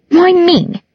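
very high petulant whine; high, sulky protest. A narrator's reading. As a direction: whine it like being picked on. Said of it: "Isabel looked petulant".

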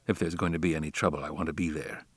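soft, low inward thought, quickly spoken; musing to himself. A narrator's reading. Ethan reflecting privately on possible trouble at home, musing. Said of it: "was his vague reflection".

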